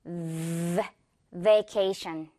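long low buzzing "V", then "vacation"; phone-buzz V. Shown as a demonstration. Teacher likening V to phone vibration, buzzing demo. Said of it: "핸드폰 진동 있죠?".

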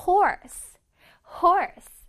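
Horse said loud with a clear, full r; clear r sound. Shown as a demonstration. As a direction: give Horse a strong, exact r sound. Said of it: "오알 발음을 아주 정확하게 내줘야 돼요".

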